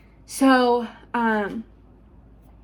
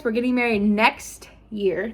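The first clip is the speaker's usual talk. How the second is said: ordinary talk, nothing marked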